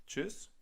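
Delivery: Tschüss said high, the German way with a rounded ü. Shown as a demonstration. Teacher modelling Tschüss to learners, high and crisp. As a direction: pitch up and say Tschüss with a crisp tsch and short ü.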